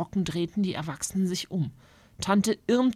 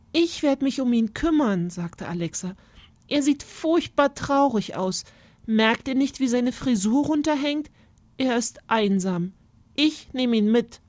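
put-on a girl, higher, pleading for the bird; earnest girl; 5 semitones higher than usual. Alexa tells her family the bird is sad, earnest. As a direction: insist the bird is lonely.

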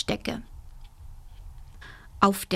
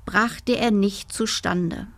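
plain reading voice

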